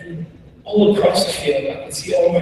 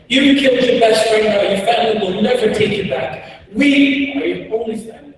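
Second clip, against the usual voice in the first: raised, forceful commanding voice; rebel commander; 6 semitones higher, 4 dB louder than usual. Retold or imitated. Rebel commander claiming a child as his own, raised forceful voice. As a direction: pronounce it as a loud, high-pitched verdict. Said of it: "rebel commander comes behind me and he grabs my shoulder and said".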